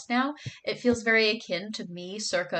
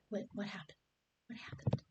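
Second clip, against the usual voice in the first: whispered; 13 dB quieter than usual. Retold or imitated. Reading viewer comment softly.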